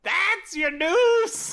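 slow, high, loud disbelieving yell; outraged slow shout; indignant shout. Retold or imitated. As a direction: drag it out in mock outrage. Ranting about disappointing news.

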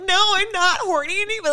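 high-pitched imitation of tearful protest; mock crying. Retelling friend's sudden turn-off, mock tearful wail. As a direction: whine it like a friend bursting into tears. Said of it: "she immediately started crying".

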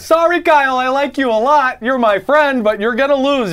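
high shrill mocking voice; shrill screeching bird woman. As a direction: squeal it like a screeching bird lady. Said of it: "normally talk to Kyle in this voice".

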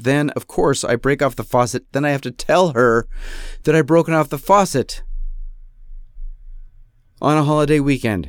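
ordinary talk, nothing marked

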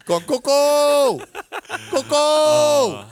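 high, loud, drawn-out holler; yelled name. Retold or imitated. Teasing a friend by yelling the name down halls, yelling. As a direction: stretch the name into a high hallway yell. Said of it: "what I was yelling to you".

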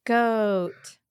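"Goat" with a lengthened vowel; long, slow "Goat"; drawn out. Shown as a demonstration. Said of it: "And the three second?".

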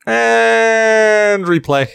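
slow, drawn-out high announcer call. Pretend. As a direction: stretch it like a game announcer. Game restart call.

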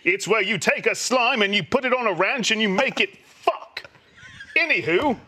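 grand, swooping English actor voice; Matt Berry. Pretend. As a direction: sign off like a plummy British thespian. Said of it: "say goodbye in that voice".

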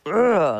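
loud guttural grunt; caveman grunt. Shown as a demonstration. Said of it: "embody your caveman".

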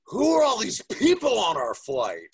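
put-on loud kid question. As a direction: blurt it out like an indignant little kid. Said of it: "he said it so loud".

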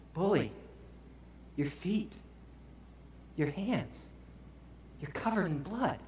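halting, hushed alarm with pauses between phrases; hushed shock. Retold or imitated. Wife noticing her husband's bleeding hands and feet, hushed shock. Wife's shocked discovery of his injuries.

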